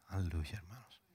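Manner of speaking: soft, breathy whisper of praise; hushed awe; whispered hallelujah. Real, not acted.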